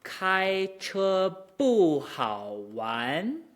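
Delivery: exaggerated tones on "Kai che bu hao wan"; drawn out, each tone carefully shaped; tone demo. Shown as a demonstration. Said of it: "this is a natural exit".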